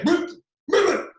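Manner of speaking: high, loud "boop" yelps; imitated rap ad-lib as a tic; mock tic noise. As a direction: blurt sudden high-pitched boops. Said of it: "like, Tourette's, that all he does".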